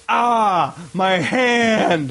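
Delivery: high, stretched-out wail; milk the pain theatrically; hammed-up howl of agony; mock pained cry. Acting out burned man's wailing.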